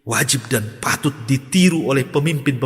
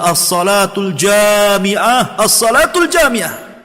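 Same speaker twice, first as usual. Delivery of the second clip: drawn-out ringing call, slow and high; call to gather; 6 semitones higher, 4 dB louder, much slower than usual. Retold or imitated. Umar's herald summoning the crowd, a long drawn call.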